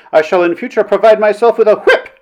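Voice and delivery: high, loud warning; threatening. A narrator's reading. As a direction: threaten the hecklers indignantly. Threat to the hecklers.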